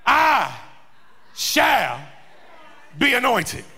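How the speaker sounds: "I", "shall", "be anointed" each set apart by a pause; emphatic declaration. Acted. Preacher quoting the psalmist, head up, "I shall be anointed" emphatically spaced out. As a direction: land "I" and "shall" as separate, emphatic beats. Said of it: "He says emphatically".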